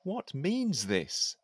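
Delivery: measured, declaiming poem reading; poem recitation. Acted. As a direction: recite it like a courtly poet. Recites his poem aloud.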